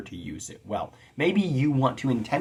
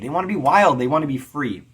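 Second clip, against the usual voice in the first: fervent declaration; 5 semitones higher, 7 dB louder, faster than usual. Acted. Voicing free-spirited writers.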